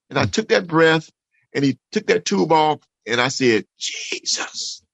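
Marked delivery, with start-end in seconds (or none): 3.8-4.8 a hushed, awed whisper of thanks; whispered prayer. Retold or imitated. A patient speaking his first word after the tube comes out, whispering. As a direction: breathe the name out with almost no voice.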